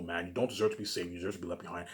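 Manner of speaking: usual speaking voice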